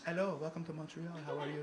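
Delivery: put-on soft, polite French-accented greeting; Parisian French accent. Montrealer welcomes a visitor, soft French accent. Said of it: "Parisian French".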